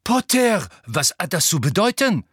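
loud, high, shocked demand; startled cry. A narrator's reading. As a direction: cry out at the intruder in alarm. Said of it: "vor Schreck aufschrie".

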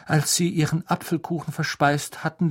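ordinary delivery, nothing marked

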